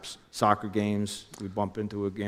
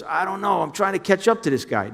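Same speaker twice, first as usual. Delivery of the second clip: much higher, urgent voice for Jimmy; imitating Jimmy calling, pitched way up; Jimmy on the phone; 11 semitones higher, 6 dB louder than usual. Jimmy chasing him on phone.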